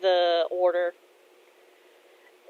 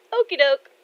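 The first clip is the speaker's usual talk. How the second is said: perky, louder okie doke with a knowing grin; cheeky chirp; 7 semitones higher, 5 dB louder than usual. Acted. Kotetsu chirps at Muramasa, teasingly knowing and upbeat. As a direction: toss it off brightly, playing innocent.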